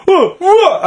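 high-pitched retold challenge; lost it. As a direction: fire it out like a confrontation. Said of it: "Like, lost it".

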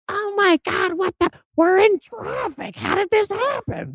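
very high, shrill Homer-like lady; angry lady impression. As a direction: squeal it like a rattled driver. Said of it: "that's really what she sounded like".

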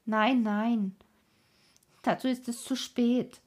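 decided, emphatic refusal; very firm. A narrator's reading. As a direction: contradict firmly, leaving no doubt. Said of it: "entgegnete die Schwester sehr bestimmt".

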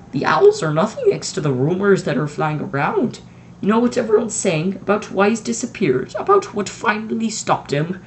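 lightly voiced strict professor, urgent; McGonagall voice. A narrator's reading. Strict witch pressing the headmaster about rumours, urgent.

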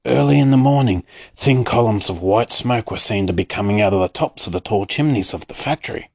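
ordinary delivery, nothing marked

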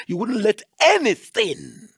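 high, loud rising preacher's cry; a preacher hitting the peak of the sermon; preaching at full force. Real, not acted. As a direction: build to a shouting climax. Preaching climax.

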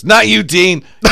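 loud, high brush-off; comic snapping. Retold or imitated. Comic waves off Dean, loud and high. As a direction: cut him off sharply. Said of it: "He goes".

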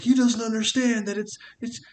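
very high, loud anguished cartoon voice; hammed-up high lament, straining; Duke. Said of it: "his name was, like, Duke something".

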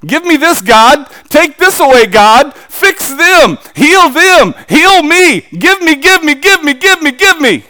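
high, loud, insistent begging; demanding prayer. Retold or imitated. Preacher mimicking selfish Christians praying, loud and demanding.

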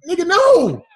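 high, loud outburst; outraged refusal. Retold or imitated. Nephew refusing his jailed uncle's smuggling plan, outraged refusal. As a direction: yell it in comic outrage.

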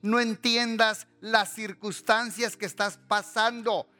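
high, forceful preacher with drawn-out words; impassioned preaching. Acted. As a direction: exhort the congregation, stretching key words. (predicación apasionada, aguda y con palabras alargadas)

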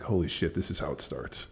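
low, hushed aside to himself; inner thought. Retold or imitated. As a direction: quietly voice a private realization. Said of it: "in the back of my head".

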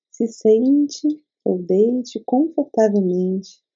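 guided meditation. Acted. Leads a meditation.

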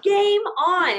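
high, loud triumphant call; game on. Retold or imitated. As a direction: announce it like you just won. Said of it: "I'm like".

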